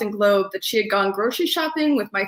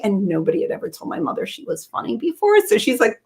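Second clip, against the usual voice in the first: ordinary delivery, nothing marked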